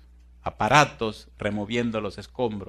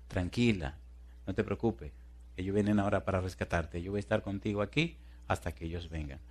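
acted reassurance; 2 semitones lower, 8 dB quieter than usual. Fireman stays with trapped woman, softly reassuring. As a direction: soothe gently in a lowered voice.

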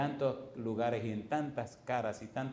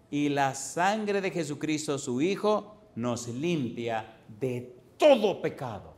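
solemn scripture reading; 2 semitones higher, 4 dB louder, slower than usual. Acted. Lee la Biblia solemne.

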